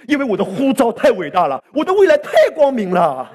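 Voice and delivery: building to a loud, high shout; preaching at full force. Real, not acted. Preaching climax, repeated refrain. (越讲越激昂的讲道高潮)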